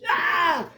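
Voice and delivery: high, loud "Ah!"; short storybook shriek of fright; startled scream. Acted. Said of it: "He screams".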